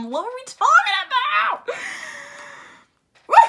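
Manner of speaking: loud high laughing disbelief; real laughing shout. Real, not acted. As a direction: laugh it out in shock.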